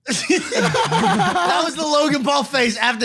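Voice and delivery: high, slow words through laughter; laughing disbelief. Real, not acted. Telling friends the grandpa story, laughing through it.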